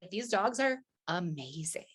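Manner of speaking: slow, unvoiced whisper of admiration; awed whisper. Real, not acted. Praising her huskies, a slow awed whisper. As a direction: breathe it out in awe.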